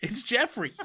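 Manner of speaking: laughing denial. Retold or imitated. Defending himself to his ex.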